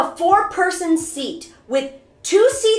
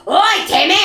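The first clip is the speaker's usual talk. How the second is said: mock angry shout; 3 semitones higher, 4 dB louder than usual. Imitates startled Yakuza man shouting.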